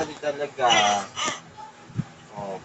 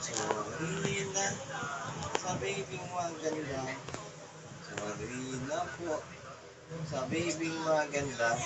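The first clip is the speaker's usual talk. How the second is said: tender soothing; 7 dB quieter than usual. Real, not acted. Dad saying sorry to his pretty baby, tender soothing. Parent cooing apology to baby.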